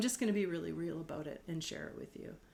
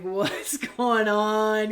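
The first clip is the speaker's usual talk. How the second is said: slow, puzzled imitation of Dad; mock-bewildered father asking what's up; dad's baffled question; 9 dB louder, much slower than usual. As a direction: drag it out like a confused dad. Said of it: "Dad's in there just kinda like".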